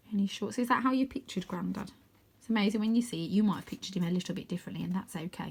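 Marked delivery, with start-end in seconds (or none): none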